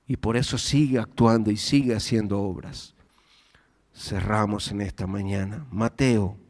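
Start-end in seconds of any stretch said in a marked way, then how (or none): none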